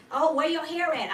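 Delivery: loud high mock gossip voice; nosy friend. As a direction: play the prying acquaintance, loud and high. Mock nosy friend questioning Aquarius.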